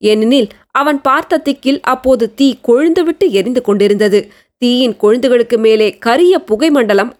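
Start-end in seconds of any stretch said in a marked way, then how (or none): none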